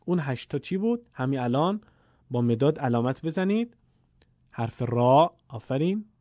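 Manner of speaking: ordinary talk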